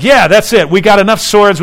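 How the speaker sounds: cocky disciples cheering; hammed-up victory cry; mock triumphant. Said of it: "it's like".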